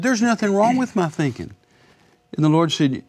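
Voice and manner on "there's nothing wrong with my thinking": high-pitched retold protest; mildly offended. Young man objecting to the Lord's correction, mildly offended. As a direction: push back with mild indignation, pitched up. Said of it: "it kind of offended me".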